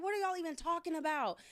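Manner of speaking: baffled teacher asking the class; exasperated. Retold or imitated.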